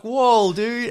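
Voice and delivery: loud, high mock astonishment; taken aback. Driver reacting to the truckie's honk, high stunned disbelief. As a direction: pitch it way up, stunned and exasperated.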